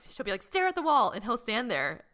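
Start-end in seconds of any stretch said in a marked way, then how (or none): 0.4-1.1 high-pitched mock command to a child; strict mother. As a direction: order a toddler into time-out. Voices sister ordering child.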